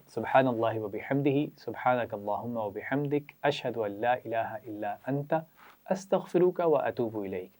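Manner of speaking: slow, soft prayerful recitation; dua recitation. Acted. Teacher leading children in a closing dua, slow and soft. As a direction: recite it slowly and softly for children to repeat.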